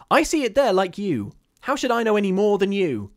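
high, loud agitated protest; exasperated retort. A narrator's reading. Caroline to her frightened sister, exasperated. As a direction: protest loudly that you cannot know.

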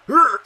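sharp high loud grunt; frustrated grunt. Real, not acted. Gamer grunting at a near miss, high loud grunt. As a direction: let a loud strained grunt burst out. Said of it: "I make fucking weird-ass grunting noises".

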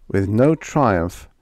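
ordinary delivery, nothing marked

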